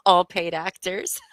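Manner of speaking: said through a laugh. Real, not acted. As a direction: laugh while you say it. Laughing at the claim.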